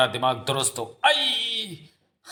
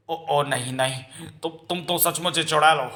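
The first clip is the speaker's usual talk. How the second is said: stammering shock, rising at the end; falter, then cry out in alarm; horrified realisation; 2 semitones higher than usual. Acted. Father discovering the girl truly is a witch, horrified.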